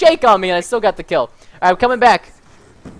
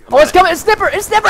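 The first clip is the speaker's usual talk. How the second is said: higher, urgent callout; excited alarm; 7 semitones higher, faster than usual. Real, not acted. Player spotting the sniper for a teammate, excited alarm. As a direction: warn your teammate urgently. Spots an enemy sniper mid-game.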